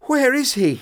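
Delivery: high, loud frantic demand; frantic question. Acted. Victor asking his father about Henry, high and frantic. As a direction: beg to know, high and loud.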